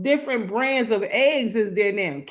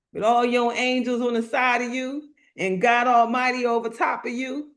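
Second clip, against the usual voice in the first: normal talking voice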